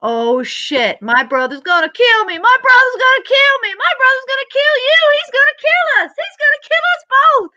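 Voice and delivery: building from worried to shrieking high panic; mock panic. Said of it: "and they crash it".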